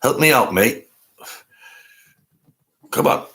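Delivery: lightly put-on English accent; mock-British plea to a mate; bad English accent. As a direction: have a rough go at English. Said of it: "Bad accent, English accent".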